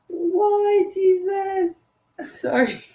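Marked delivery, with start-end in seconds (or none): none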